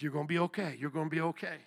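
low, hushed reassurance; reassuring God. Retold or imitated. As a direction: voice God gently soothing someone who is crying. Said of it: "God is like".

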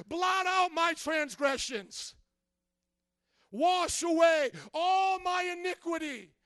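pleading prayer at full voice; crying out to God. Acted. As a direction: shout for mercy from the gut. Pastor cries out a psalm.

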